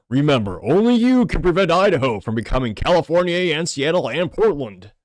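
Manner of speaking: a rough, hammed-up stab at Smokey's PSA delivery; Smokey the Bear voice. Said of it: "my rudimentary attempt at the Smokey the Bear accent".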